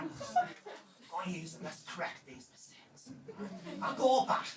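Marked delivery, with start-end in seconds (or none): 0.0-3.1 a near-whispered aside, much softer; under his breath, secretive; muttered to himself. Retold or imitated.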